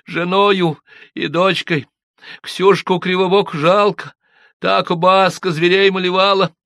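put-on high, slow, grieving girl's voice; tearful village girl. A narrator's reading. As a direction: lament for the lost villagers. Manefa's tearful account. (плачущая деревенская девушка)

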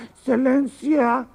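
slow, drawn-out fawning excelencia; bow and scrape to the boss; servile pilot. Acted. Servile pilot drawing out his excelencia to the boss.

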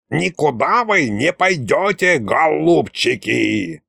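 creaky, drawn-out villain gloat. A narrator's reading. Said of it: "раздался вдруг злорадный скрипучий голос".

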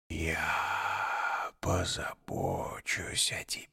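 slow, soft rustling serpent voice; ingratiating whisper. A narrator's reading. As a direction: promise with sweet menace. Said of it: "вкрадчиво прошелестел змеиный голос".

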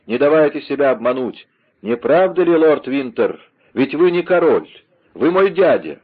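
high, loud, taunting accusation; exclaimed. A narrator's reading. Rider unmasks Lord Winter as his uncle, exclaimed. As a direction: call out the unmasking with rising force. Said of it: "воскликнул всадник".

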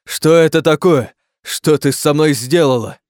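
loud, high accusing questions; angry demand. Acted. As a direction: lash out at the one responsible. Creature accuses princess.

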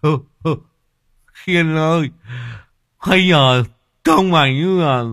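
high, loud, slow laughing tease; high chuckling taunt between laughs; cackling mockery. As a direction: laugh at the swollen face. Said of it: "cười lên sằng sặc".

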